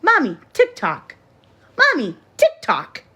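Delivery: imitating little boy. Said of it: "What did you just say?".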